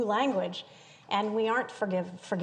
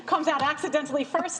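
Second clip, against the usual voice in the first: laughing; 7 semitones higher, 4 dB louder than usual. Real, not acted. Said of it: "laughing while answering".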